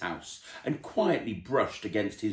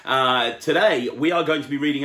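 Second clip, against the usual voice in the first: loud, bright welcome to the viewers; upbeat show host intro; 5 semitones higher, 7 dB louder than usual. Acted. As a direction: greet the audience like a YouTube host. Opens the story-time show.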